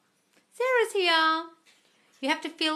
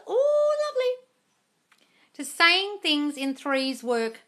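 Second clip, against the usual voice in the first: mock sweet voice; 8 semitones higher, 5 dB louder, much slower than usual. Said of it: "reading viewer comment".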